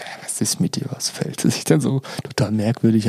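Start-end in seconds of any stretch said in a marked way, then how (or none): 0.0-1.4 breathy whisper, no voice at all; whispered question. Retold or imitated. Asking his partner outside the toilet, in a whisper. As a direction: whisper it as if worried she might hear.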